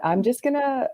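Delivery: ordinary delivery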